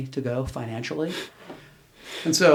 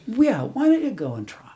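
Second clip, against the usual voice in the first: imitating wife; 10 semitones higher, 5 dB louder than usual. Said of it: "and she said".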